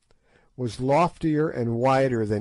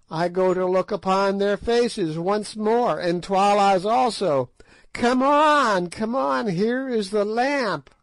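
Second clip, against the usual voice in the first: piping witch; 8 semitones higher than usual. A narrator's reading. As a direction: pipe it out like an ancient sly witch. Narrator voices Gagool.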